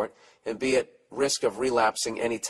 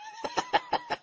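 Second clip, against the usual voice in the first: short high giggle; quick burst of high laughing; real laughter; 6 dB quieter than usual. Real, not acted. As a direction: crack up briefly at your own joke. Laughs at own joke.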